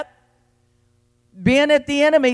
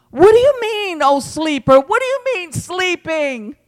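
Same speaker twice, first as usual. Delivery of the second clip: high, ringing challenge to sleepers; incredulous reproach; 6 semitones higher than usual. Retold or imitated. Preacher voices God's call to sleeping believers, incredulous. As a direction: call them out with raised pitch.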